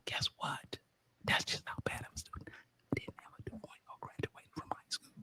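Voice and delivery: hushed, voiceless whisper; whispered confession. Acted. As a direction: whisper it like a shameful secret. Confesses his bad grades, drops to whisper.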